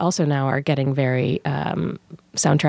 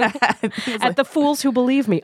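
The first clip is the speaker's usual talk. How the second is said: evil villain; 6 semitones higher, slower than usual. Pretend. Playing villain after hero line.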